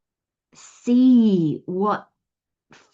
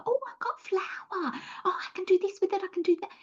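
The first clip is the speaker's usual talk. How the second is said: much higher, softer, eager little voice; mock excited baker; 12 semitones higher, 6 dB quieter, faster than usual. Spiritual reader voicing an eager beginner, high and soft. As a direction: play the delighted novice baker.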